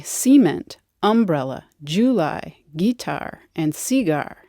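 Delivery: "cement, umbrella, July, guitar, and cigar" each stressed up front; regional first-syllable stress. Shown as a demonstration. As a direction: say CE-ment, UM-brella, JU-ly, GUI-tar, CI-gar. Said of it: "where some say".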